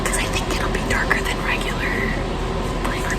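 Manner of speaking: rushed, hushed late-night whisper; frantic whisper. Real, not acted. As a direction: whisper fast so the parents stay asleep. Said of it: "I have to whisper 'cause my parents are sleeping".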